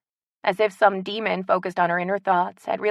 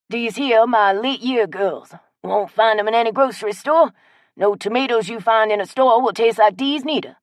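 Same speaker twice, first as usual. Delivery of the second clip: put-on old Cajun man; 3 semitones higher, 7 dB louder than usual. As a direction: show off your tomatoes with pride. Chet offering tomatoes.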